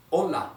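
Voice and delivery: Hola with a silent h, said high and clear; model hola. Shown as a demonstration. Teacher models "Hola" for students to repeat. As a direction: say "Hola" as "ola", no h sound. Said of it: "Repeat after me".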